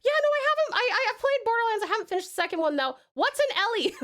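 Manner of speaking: high, quick self-mocking ramble; mock clueless self. Streamer mocking her forgetful self, flustered and high. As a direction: play your own clueless self, flustered. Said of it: "I am like".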